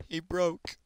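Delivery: high, slow mock lament; mock heartbreak. Player grieving his broken pickaxe, mock heartbroken. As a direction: mourn a tool with drawn-out, high-pitched drama.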